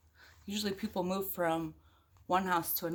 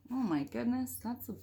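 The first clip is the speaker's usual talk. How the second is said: ordinary delivery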